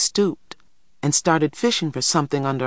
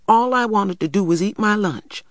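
put-on higher-pitched child's protest; denying; 7 semitones higher than usual. A narrator's reading. Little boy only wanted his lunch, denying. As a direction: insist on your innocence like a small boy. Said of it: "denied Christopher John".

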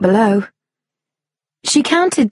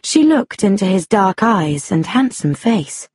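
plain reading voice

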